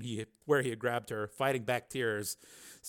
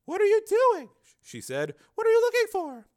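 high female voice, louder, anxious; anxious woman; 7 dB louder than usual. Acted. Susan to Steele as he searches, anxious. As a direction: question him in high-pitched alarm.

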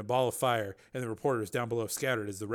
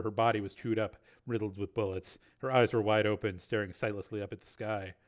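ordinary delivery, nothing marked

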